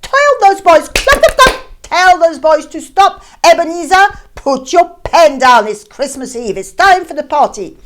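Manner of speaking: loud, high, hurried call across the shop. Acted. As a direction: shout for Mr Fezziwig to come, then hurry the boys. Fezziwig's clerk calling out to him.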